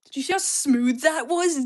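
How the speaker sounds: loud, amazed call to viewers; excited boast. Real, not acted. Crafter showing viewers a smooth move, excited shout. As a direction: blurt it out loud, thrilled with your own move.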